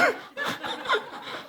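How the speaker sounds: breathy high giggle, trailing down; can't hold back the laugh; wheezy falling giggle; airy laugh. Real, not acted. Laughs at his own joke.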